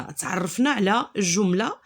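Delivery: usual speaking voice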